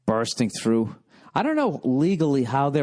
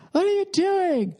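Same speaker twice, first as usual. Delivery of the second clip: much higher outraged woman's shriek; imitating shrill woman. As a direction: mimic the shopper yelling as the TV is dragged off. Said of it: "She's going like".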